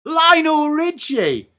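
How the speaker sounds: stretched, high showman call; excited drawn-out call. Acted. Excited repeat of the name.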